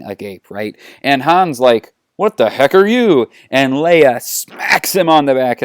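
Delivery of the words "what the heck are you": high, loud, incredulous question; baffled outburst. Acted. Han sees Yoda for the first time, loudly baffled. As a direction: blurt it out, pitched well up.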